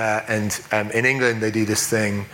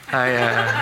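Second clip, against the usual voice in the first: awkward laugh. Retold or imitated. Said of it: "And she, uh".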